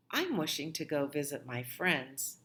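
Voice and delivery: usual speaking voice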